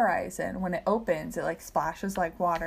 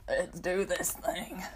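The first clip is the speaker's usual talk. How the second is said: whisper; 5 dB quieter, slower than usual. Real, not acted. Starting the episode.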